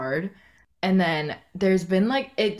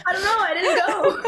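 laughing mock reply; 12 semitones higher, 4 dB louder than usual. Mimicking friend's reply, laughing.

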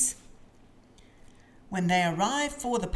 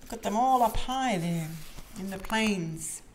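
plain reading voice

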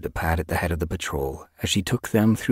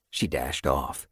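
usual speaking voice

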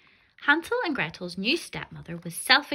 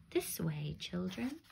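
much softer, sly and coaxing; soft sly witch; 11 dB quieter, slower than usual. Acted. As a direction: lure them in gently. Witch lures children in.

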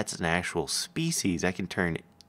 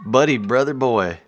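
loud greeting; 3 semitones higher, 8 dB louder than usual. Real, not acted. Calling out to friend.

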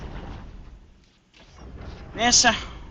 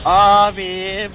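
loud, exasperated "Abi"; frustrated yell at the game; exasperated shout; 8 dB louder than usual. Real, not acted. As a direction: blurt it out in frustration. Exasperated outburst at the mod.

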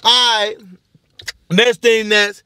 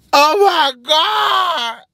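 high, slow wailing sobs; fake crying; 9 semitones higher, much slower than usual. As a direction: wail like you just got shot. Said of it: "that's me practicing".

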